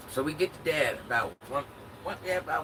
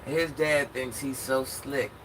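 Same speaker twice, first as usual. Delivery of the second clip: ordinary talk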